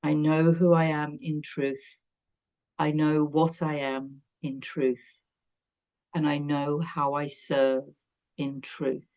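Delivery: calm affirmation fading to a hush. Shown as a demonstration. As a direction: recite it like a quiet mantra. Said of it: "That's a beautiful affirmation".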